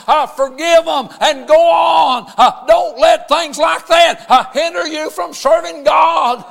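loud, rhythmic preaching with shouted peaks. Acted. Pastor preaching to his congregation, shouting at full force. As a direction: preach it hard to the church, drawing out the refrain.

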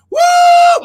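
piercing high, loud whoop; a long ringing whoop of joy. Real, not acted. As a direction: ecstatic church whoop. Praise eruption.

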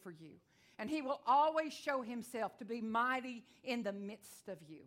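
loud, slow preaching proclamation. Acted. Preacher declaring to the church, loud and slow. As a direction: declare it to the congregation.

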